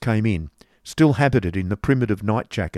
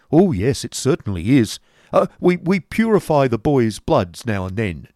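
a hint of the fussy schoolmaster; lightly voiced Squeers; 3 semitones higher, 5 dB louder than usual. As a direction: explain it a bit flustered. Squeers explains to Nicholas.

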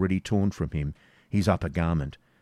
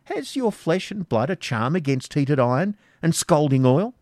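higher, harsh baron's threat; stern threat; 8 semitones higher, 4 dB louder than usual. Acted. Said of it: "said the Norman sternly".

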